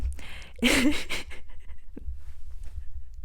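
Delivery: long high breathy giggle; can't stop giggling; laughing. Real, not acted. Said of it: "No sé por qué me estoy riendo tanto".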